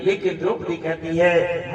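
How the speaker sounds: ordinary talk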